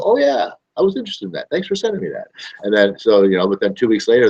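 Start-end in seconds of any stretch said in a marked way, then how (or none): 0.0-2.3 high, quick voice of a suddenly reminded customer; mock customer. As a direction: chirp it like someone who just remembered. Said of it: "And they go".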